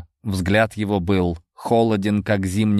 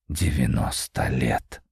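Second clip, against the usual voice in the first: low, slow musing repetition; thoughtful; 8 semitones lower, 5 dB quieter, slower than usual. A narrator's reading. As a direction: taste the words slowly. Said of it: "задумчиво проговорил он".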